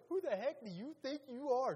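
loud, high indignant demand; acted outrage. Religious leaders demand an answer from Jesus, loud acted outrage. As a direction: throw it out as an exasperated challenge.

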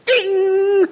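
high, loud, drawn-out imitated ding; ringing ding. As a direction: sound off a piercing "ding" like an alarm. Neck hairs standing on end.

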